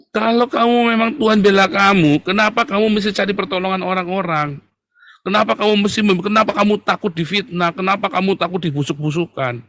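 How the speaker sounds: loud, high-pitched challenging questions; preaching at full force. Real, not acted. (berkhotbah lantang dengan nada tinggi)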